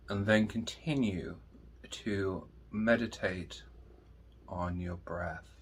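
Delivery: soft, slow meditation voice with long pauses; guided meditation. Acted. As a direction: guide listeners gently inward, hushed and unhurried.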